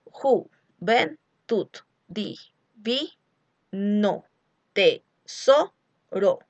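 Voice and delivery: very slow, every syllable pulled apart; drawn-out metrical reading of the verse; scanning syllables. Shown as a demonstration. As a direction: count out the metre syllable by syllable. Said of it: "contemos número de sílabas".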